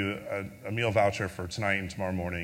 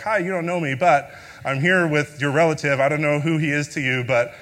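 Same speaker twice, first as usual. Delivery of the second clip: raised voice, speaking up to be heard on the phone; loud phone voice; 4 semitones higher, 8 dB louder than usual. Retold or imitated. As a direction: call out brightly to a stranger on the line. Calling stranger's family by phone.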